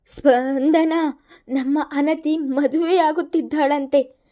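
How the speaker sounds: a touch of tremble in a loud voice; trembling voice. A narrator's reading. Husband telling wife the news, trembling. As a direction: say it barely holding together. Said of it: "ನಡುಗುವ ಸ್ವರದಲ್ಲಿಯೇ".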